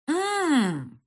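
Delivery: high, loud, mocking "mmm"; snide drawn-out hum. A narrator's reading. Mother mocking father's claim, snide long hum. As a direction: draw out a sarcastic hum. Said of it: "протянула ехидно".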